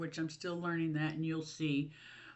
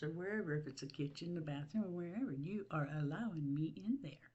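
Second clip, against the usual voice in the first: softer, unvoiced whisper; 3 semitones lower, 7 dB quieter than usual. Real, not acted. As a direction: let them in on it in a hush. Confiding to viewers.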